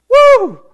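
high, loud whoop of amazement; exclaimed woo. Real, not acted. Preacher reacting to a verse on mercy, loud whoop. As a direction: let out a big high "woo".

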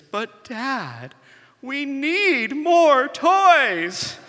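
put-on confused three-year-old, high and drawn out; high concerned little girl. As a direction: stretch it out high like a worried toddler. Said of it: "her voice starts to get a little higher".

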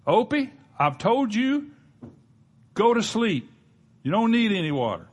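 a preacher playing a fed-up dad; firm scolding father. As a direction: call up the stairs to a disobedient son. Father scolding son up stairs.

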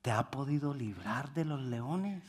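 soft, hushed, anxious asking; fearful hope in the voice; sad trembling question. Acted. King asking Daniel at the den, hushed and sad. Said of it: "más voz así de".

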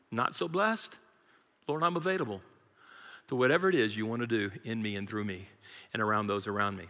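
soft prayer. Real, not acted. Quiet prayerful surrender.

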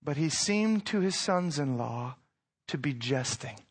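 ordinary delivery

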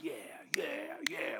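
mock whispered yeah. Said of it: "you're like".

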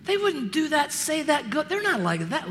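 gasp, then a high, scandalized "oh"; mock shock. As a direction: play a scandalized churchgoer. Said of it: "Would anyone be shocked".

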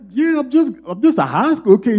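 put-on whiny teen son. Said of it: "I was like".

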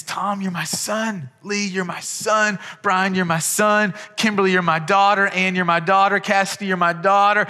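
a lightly voiced loving father; God's voice. Pastor voicing God claiming each listener, tender declaration. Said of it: "God looks at you and says".

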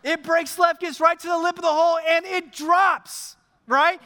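high-pitched, exultant play-by-play; loud climax, last word stretched; excited commentary. Acted. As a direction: burst out as the putt drops. Putt drops, tension bursts.

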